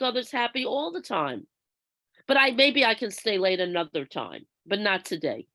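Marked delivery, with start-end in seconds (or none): none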